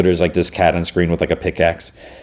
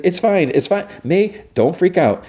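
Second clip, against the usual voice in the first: nervous reassuring; 12 semitones higher than usual. Retold or imitated. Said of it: "He's like".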